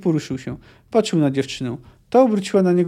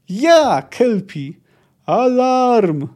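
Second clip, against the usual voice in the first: high, loud calls in narration; raised cries with drawn-out vowels; urgent shouting; 7 semitones higher, 5 dB louder, much slower than usual. A narrator's reading. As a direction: shout out commands and alarm. Urging horse, alarm raised.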